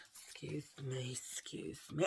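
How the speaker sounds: soft, slow excuse me; quiet apology. Real, not acted. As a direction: murmur the apology. After a burp, apologising.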